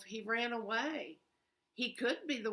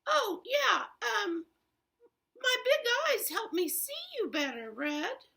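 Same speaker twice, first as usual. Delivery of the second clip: high, loud, fast bluffing granny voice; flustered wolf granny; 12 semitones higher, 4 dB louder than usual. Acted. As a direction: hurry to cover the lie, pitched way up.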